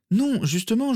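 put-on very high girl's voice; jeune fille insistante. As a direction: try to get a word in, high and eager. Girl tries to interrupt professor. (voix très aiguë, insistante)

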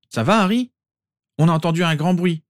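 put-on a teen calling out, worried; Ron's voice. A narrator's reading.

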